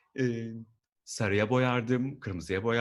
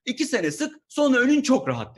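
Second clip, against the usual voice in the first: high, louder singsong boss promise; mock manager promise; 9 semitones higher, 6 dB louder than usual. Comedian mimics a manager's cliché office promise. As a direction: sell it like an empty promise.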